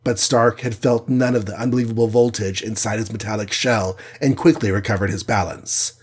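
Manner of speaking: ordinary delivery, nothing marked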